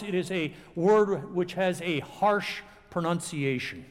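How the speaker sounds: ordinary talk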